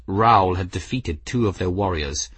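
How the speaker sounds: usual speaking voice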